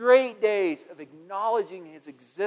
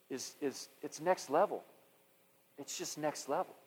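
soft, reverent, much quieter; hushed prayer; 5 dB quieter than usual. Retold or imitated. Hunter retelling his quiet prayer to the Lord, soft and reverent.

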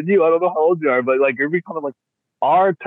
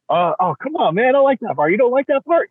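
mock protest; 9 semitones higher than usual.

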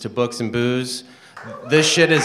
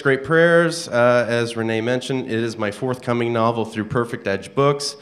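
normal talking voice

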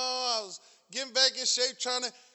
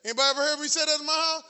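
usual speaking voice

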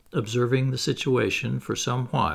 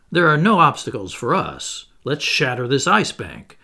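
sarcastic; 4 semitones higher, 6 dB louder than usual. A narrator's reading. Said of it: "I went on in a tone of some sarcasm".

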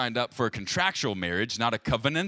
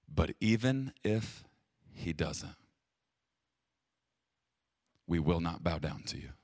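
quiet solemn vow; 8 semitones lower, 6 dB quieter than usual. Acted. Reads the verse of defiance.

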